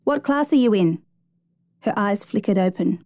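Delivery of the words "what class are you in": higher-pitched insistent question; raised voice. A narrator's reading. Rescuer keeping an injured girl conscious, mildly raised voice. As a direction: ask it pitched up to keep her awake. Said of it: "he said loudly".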